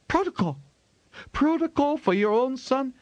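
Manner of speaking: furious wife rejecting protocol for her own son; indignant outburst. A narrator's reading. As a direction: throw the word back at him in disbelief. Mother furious at father's rule.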